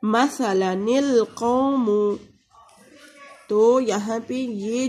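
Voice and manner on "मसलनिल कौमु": "मसलनिल कौमु" drawn out, nun joined to lam; slow Quranic recitation. Shown as a demonstration. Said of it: "जैसे".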